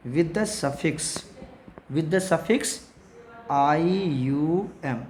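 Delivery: very slow, spaced dictation. Shown as a demonstration. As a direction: draw out each word for note-taking. Teacher dictating rule slowly.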